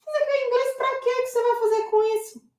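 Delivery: high, held-up pitch, dismissive questioning; put-on high voice of a doubter; mocking naysayer. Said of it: "que ele ouve assim".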